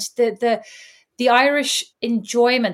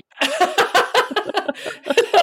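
high, helpless giggling; real peal of laughter; long laugh; 11 semitones higher than usual. Real, not acted. Friends mock the earnest response, high laughter.

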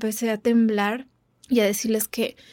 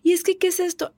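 high, frightened pleading questions; reliving the panic of being dragged off; panicked cry; 8 semitones higher than usual. Retold or imitated. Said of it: "yo gritando y diciendo". (mujer arrastrada a la clínica, suplicando con pánico agudo)

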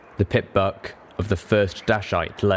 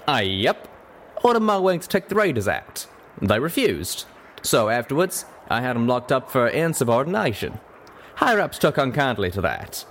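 higher-pitched folksy Western character; drawling cowpony; 4 semitones higher than usual. Acted. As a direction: tell your story as a plain-spoken cowpony.

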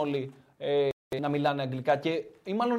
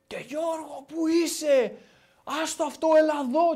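mock coach shout, pitched higher; retold coach yelling; 10 semitones higher, slower than usual. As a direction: call Giorgos over like an impatient coach, half-imitated. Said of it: "αρχίζει ο coach και φωνάζει".

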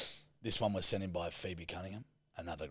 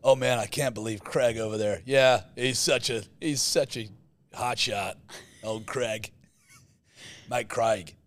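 louder, lightly put-on American; American accent; 4 dB louder than usual. Friend gushing about hot shot Craig in a light American accent. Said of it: "the way Americans pronounce the name Craig".

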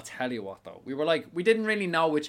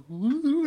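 much higher mock spooky woo; clown whoop; 13 semitones higher, 3 dB louder than usual. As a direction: woo like a clown doing all this. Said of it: "they're like".

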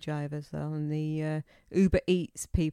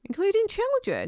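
shocked disbelief; 12 semitones higher, 4 dB louder than usual. Real, not acted. Shocked repeat of fact.

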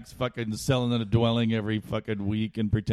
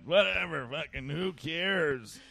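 put-on higher then slow and trailing, a drunk who cares about nothing; mock careless; 6 semitones higher, 5 dB quieter, slower than usual. Comic at a gig recalling his drunk bravado, lightly mock careless. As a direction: shrug it off slowly, half-drunk.

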